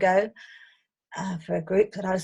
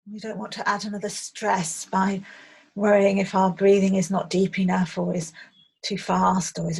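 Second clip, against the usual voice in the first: guided meditation; 3 dB louder than usual. Acted. Meditation teacher leading listeners on an imagined riverside walk, calm guided visualization. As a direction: guide listeners gently, phrase by phrase.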